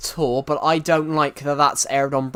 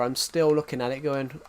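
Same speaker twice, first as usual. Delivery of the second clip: ordinary delivery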